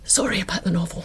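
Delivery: hushed unvoiced apology, barely audible; whisper. Real, not acted. Wife whispers an apology to her husband once Adele leaves. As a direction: whisper it so the departing friend can't hear.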